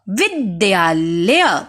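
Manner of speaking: विद्यालय said slowly syllable by syllable; teacher models विद्यालय slowly to the class; slow correct word. Shown as a demonstration. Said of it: "इसका शुद्ध रूप सीखते हैं".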